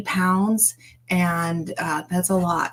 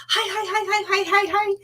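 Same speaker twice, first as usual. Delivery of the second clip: squeaky greeting; 12 semitones higher, faster than usual. Retold or imitated. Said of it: "he just slides right on in and he just".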